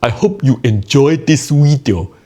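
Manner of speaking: loud, high mock Cantonese accent. Shown as a demonstration. Comedian signing off to viewers, Cantonese accent. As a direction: belt it out in a forceful Cantonese accent. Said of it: "in my Cantonese accent".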